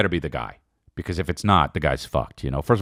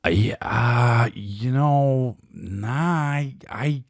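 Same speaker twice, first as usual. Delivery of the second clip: higher, slow, hesitant put-on voice; mock reluctant non-apology; 6 semitones higher, much slower than usual. To his wife, a higher, slow, halting mock non-apology. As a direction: stammer out a grudging refusal to apologise. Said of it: "I was like".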